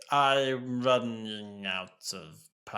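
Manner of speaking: slow robot voice sliding downward; low-battery Cyberman, winding down. Retold or imitated. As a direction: let pitch sink as power drains. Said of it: "goes down".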